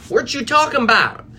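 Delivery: high, loud, hammed-up Southern accent; bad Southern accent. Shown as a demonstration. Coach demonstrating an argument interrupt, bad Southern accent. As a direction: yelp it in a badly faked Southern twang. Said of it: "that's probably a horrible Southern accent".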